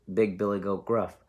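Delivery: ordinary delivery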